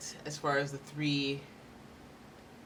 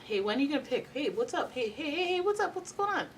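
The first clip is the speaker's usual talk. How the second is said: high, chirpy, rapid nagging hellos; pestering follow-upper; 10 semitones higher than usual. Retold or imitated. Mocking a needy applicant pestering a boss, high and chirpy. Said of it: "Don't be a follow-uppy McGee".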